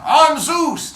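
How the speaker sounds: loud, high mock proclamation; imitated boastful shout; booming self-announcement. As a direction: announce yourself as a god, loudly. Quoting Zeus announcing himself.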